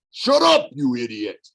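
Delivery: a sharp angry snarl at his brother; angry shout of shut up, then a growl. A narrator's reading. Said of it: "Agrias Elia growled".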